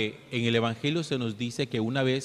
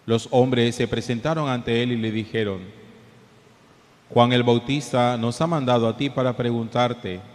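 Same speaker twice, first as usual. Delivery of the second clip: solemn gospel reading, echoing; cathedral echo; 6 dB louder than usual. Real, not acted.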